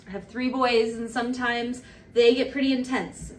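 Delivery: ordinary talk, nothing marked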